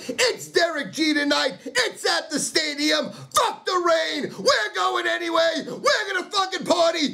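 yelling high and strained, almost cracking; rally the crowd to go out in the rain; fired-up fan hollering at Derek to show up; excited high shout. Real, not acted. Rallying fans for the game.

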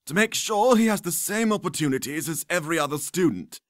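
put-on high, booming mad old wizard; loud old wizard cry. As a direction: burst in and declare it. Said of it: "cried an old man".

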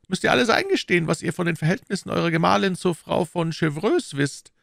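high, insistent interrogator voice; demanding cardinal. Acted. Cardinal demanding a full confession, insistent.

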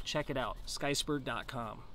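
ordinary delivery

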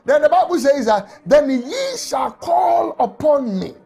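normal talking voice

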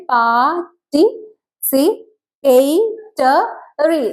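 "pa, ti, si, pe, te, ri" split by clear pauses; syllable by syllable. Shown as a demonstration. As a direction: sound out "pa, ti, si, pe, te, ri" one beat at a time. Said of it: "പക്ഷെ നമ്മൾ നോക്കുകയാണെങ്കിൽ". ("pa, ti, si, pe, te, ri" ഓരോ സിലബിളും വേർതിരിച്ച്)

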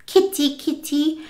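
high, sing-song kitty call; sweet old lady calling kitties; high sweet cat call. Retold or imitated. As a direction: coax the cats in a high voice.

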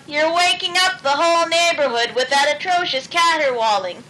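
annoyed scolding in a put-on voice; character voice. A narrator's reading. Luella scolding Otis for his noisy serenade, annoyed. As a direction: scold him for the racket.

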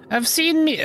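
very high put-on old woman; old lady voice. Gamer voicing the chicken lady, high old-woman voice. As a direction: pitch it far up as a struggling old-lady impression. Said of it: "I need to do a voice for the old lady".

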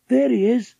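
high, loud call of discovery; crying out. A narrator's reading. Girl spotting her macaw outside the shop, crying out. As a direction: call out as you spot him. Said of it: "cried Patsy".

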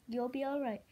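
usual speaking voice

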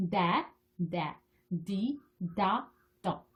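slow, separate syllables with pauses between; slow syllable drill. Shown as a demonstration. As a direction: sound out each syllable on its own. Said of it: "그래서 빨리 발음할 수 있도록". (한 음절씩 천천히 끊어 읽기)

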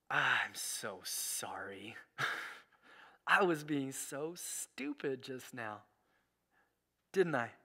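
soft, unvoiced, slow apology to his wife; whispered apology. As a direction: breathe the apology to her softly. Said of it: "and I said".

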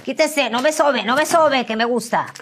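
high, loud pleading to stop; hammed-up high-pitched resistance; mock panicked protest. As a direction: fend him off loudly, weakening. Fends off touching ex, acted.

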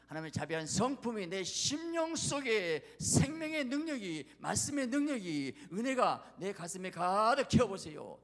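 high, loud, mounting exhortation; full-force preaching. Real, not acted. Preacher urges filling the heart with grace, impassioned. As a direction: drive each phrase out fervently.

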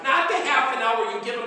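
ordinary talk, nothing marked

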